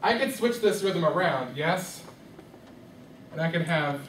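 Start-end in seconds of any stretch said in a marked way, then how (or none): none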